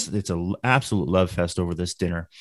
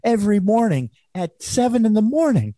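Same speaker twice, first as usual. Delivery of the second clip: very high, strained comic patient voice; Gilbert Gottfried impression; 13 semitones higher, 3 dB louder than usual. Storyteller mimics comedian's patient talking to doctor, high impression. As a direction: complain to the doctor in a pitched-up joke voice. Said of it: "He goes".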